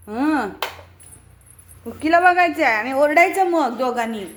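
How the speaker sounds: ordinary talk, nothing marked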